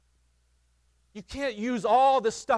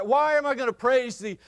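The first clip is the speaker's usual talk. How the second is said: ordinary talk, nothing marked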